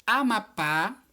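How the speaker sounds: Amapá said loud, landing on the final pá; emphatic Amapá, weight at the end; stress on last syllable. Shown as a demonstration. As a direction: dictate Amapá with a strong pá. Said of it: "também a última sílaba".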